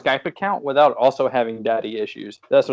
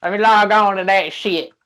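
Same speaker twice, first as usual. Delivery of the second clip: mock hillbilly; 4 semitones higher than usual. Mocking Skype users as a hollering old hillbilly. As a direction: ham up a backwoods old-timer. Hick bit continues.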